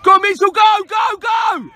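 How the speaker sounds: real sideline holler; urging shout. Real, not acted. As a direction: drive the player forward with your voice. Coach urging player forward.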